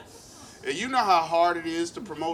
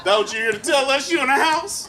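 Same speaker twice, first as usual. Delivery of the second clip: loud, high mocking yell from a homeless heckler; 12 semitones higher, 12 dB louder than usual. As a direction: shout it back at him, jeering. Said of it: "And they was like".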